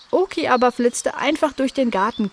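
ordinary delivery

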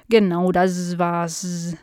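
slow, buzzing fly with stretched s sounds; forgetful housefly. Acted. Fly admitting its forgetfulness to the dog, slow and buzzy. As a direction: confess vaguely, drawing the words out.

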